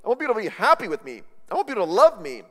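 high, loud, pleading; anxious people-pleaser. Retold or imitated. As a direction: rush it out like someone desperate to be liked. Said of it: "it's anxiety".

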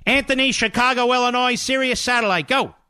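high, bright announcer call-out of the caller's name and town; radio host announcing a caller; caller intro. Acted. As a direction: bring the next caller on air with a lift.

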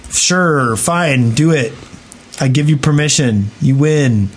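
normal talking voice

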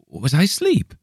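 just woken and lost; bewildered. A narrator's reading. Man waking confused.

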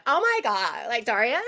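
put-on gushing Valley Girl accent. Shown as a demonstration. Actress shows off her accents to the host, Valley Girl. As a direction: do a hammed-up California Valley Girl. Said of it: "I can do Valley Girl really well".

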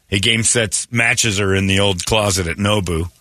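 ordinary delivery

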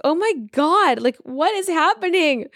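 excited disbelief. Retold or imitated. Reacting to her husband's twin video, in excited disbelief. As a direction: squeal it like the universe is sending signs.

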